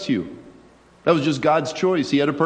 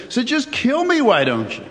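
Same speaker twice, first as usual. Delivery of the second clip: angry outburst; 7 semitones higher than usual. Acted. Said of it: "And Jonah is angry. And he says".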